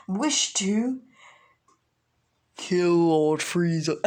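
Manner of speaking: lightly voiced villain, slow and drawn out; villain declaration. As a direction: put slow menace on the last words. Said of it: "And Raditz is like".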